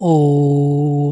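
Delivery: ओ stretched long and low, three counts; drawn-out om. Shown as a demonstration. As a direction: pull the ओ out long like a chant. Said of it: "जैसे ओम नहीं कहूंगा मैं". (ओ को लंबा खींचकर)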